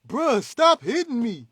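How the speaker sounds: high, loud, slow complaint; a pushover hero yelling while getting beaten up; drawn-out, high-pitched, loud protest; whiny Superman yell. Retold or imitated. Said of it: "and he like".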